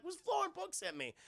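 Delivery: put-on small baby voice, lost and puzzled; confused baby voice.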